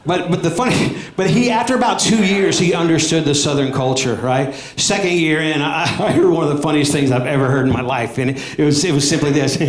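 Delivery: normal talking voice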